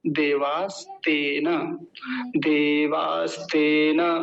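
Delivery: देवास्तेन repeated very slowly, syllables separated; slow careful pronunciation. Shown as a demonstration. Teacher repeating the word for students to copy, slow. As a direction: model देवास्तेन twice, unhurried. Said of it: "देवास और तेन आसान हो जाएगा".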